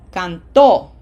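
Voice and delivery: canTO, final syllable hit loud; stress on last syllable. Shown as a demonstration. Teacher shows the other way, canto stressed on TO. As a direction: keep "can" short and punch the TO in canto. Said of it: "the other way would be".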